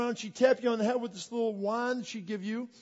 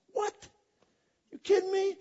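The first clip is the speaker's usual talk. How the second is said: disbelief; 8 semitones higher than usual. Retold or imitated. Reacting to forty-dollar T-shirts.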